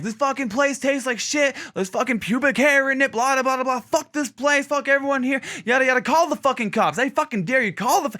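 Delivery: sustained high-pitched imitated tirade; mock hollering. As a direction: rant as the fuming man outside. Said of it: "it's hooting and hollering".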